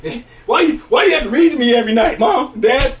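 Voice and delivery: loud, high-pitched mock complaint; mock outrage. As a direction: yell at your parents in playful protest. Mock rant at his parents.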